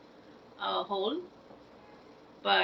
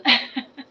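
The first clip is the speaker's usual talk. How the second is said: teary laugh; 3 semitones higher, 8 dB louder than usual. Real, not acted. Laughs at her own tears.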